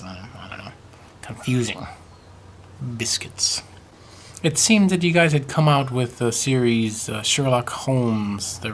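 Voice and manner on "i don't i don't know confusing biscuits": breathy baffled whisper of one word. Pretend. Vlogger baffled by British biscuits, whispering. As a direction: whisper it to yourself, confused. Muttering confusion about biscuits.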